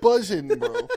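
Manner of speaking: high-pitched, laughing squeal; squealing excited. Pretend. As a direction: shriek it out in glee. Mock-disgust about smelly Portaloos.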